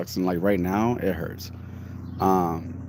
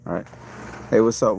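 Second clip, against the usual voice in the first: ordinary talk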